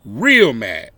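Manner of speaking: ordinary delivery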